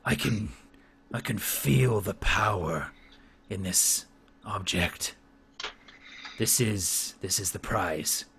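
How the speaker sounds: low, hushed, slow chieftain voice; grave warlord, soft and deep; solemn orc chieftain. Acted. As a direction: weigh each phrase with awe, long pauses between. Game master voices orc chieftain.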